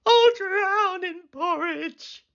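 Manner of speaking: slow, very high desperate wail; stretched-out panicked boy voice; boy's cry. A narrator's reading. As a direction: wail it as if about to drown. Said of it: "cried the boy".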